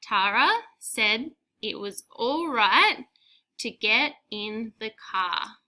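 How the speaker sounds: slow, clear dictation; dictation repeated. Shown as a demonstration. Teacher repeating dictation for pupils, slow and clear. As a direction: read the sentence again slowly. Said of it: "I'll say it again".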